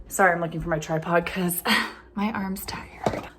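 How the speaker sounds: breathless whispered aside with a small laugh. Real, not acted. Vlogger whispering to viewers while hunting for her tripod. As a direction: drop to a whisper, half laughing.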